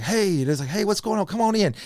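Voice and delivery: high, easy welcome; imitated friendly host. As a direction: mimic a buddy waving you in. Imitates Thomas greeting them at door.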